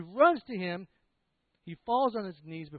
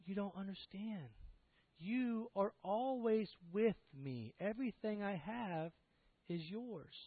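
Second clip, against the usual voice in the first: soft, slow, gently raised pitch; hushed, earnest reassurance; pleading father; 4 semitones higher, 8 dB quieter, slower than usual. Acted. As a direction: plead tenderly with an angry son. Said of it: "you see the father pleading him".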